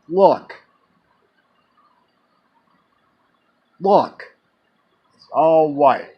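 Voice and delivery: loud, urgent look, look; pointing the sky out to a friend, loud; excited calling out. Retold or imitated. Telling his friend John to look at the stars, excited and loud.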